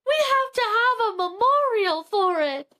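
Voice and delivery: high, weepy mock insistence; hammed-up grieving wife; mock tearful plea. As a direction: plead as if near tears. Wife upset over fish.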